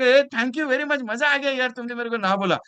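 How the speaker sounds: high, loud mock delight; cheerful refusal. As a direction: thank them gleefully for saying no. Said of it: "आपने हंसते हंसते मना कर".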